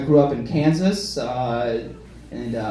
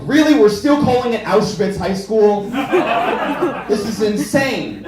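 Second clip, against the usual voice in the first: high, louder indignant disbelief; mock exasperation; 6 semitones higher, 3 dB louder than usual. Kid upset at his school's name, in high mock outrage. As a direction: protest it as if it's absurd.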